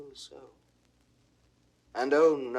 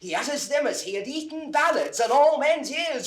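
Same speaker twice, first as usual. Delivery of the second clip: higher, louder eager calls that build into a gushing report; excited calling; 7 semitones higher, 5 dB louder than usual. Acted. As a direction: burst in breathless with news for the master. Servant bursts in calling master.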